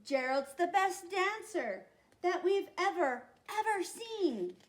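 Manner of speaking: high, loud amazed shouting; excited shout. Acted. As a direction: cheer like a crowd in awe. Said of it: "They shouted".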